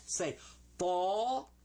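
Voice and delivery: "tall" drawn out with an open aw and a clear L. Shown as a demonstration. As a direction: model "tall" slowly for the class. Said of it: "Let's say that".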